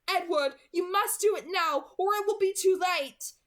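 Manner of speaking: doctor urging Edward to act, loud and urgent; urgent plea. Acted. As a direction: push him to act before it's too late. Pressing urgency in crisis.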